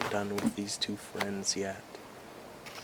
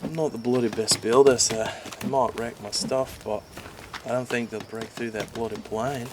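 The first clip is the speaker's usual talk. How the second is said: Richard's voice; 3 semitones higher, 3 dB louder than usual. Acted. Richard urging Sal to build better defenses, earnest. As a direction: urge your friend to build.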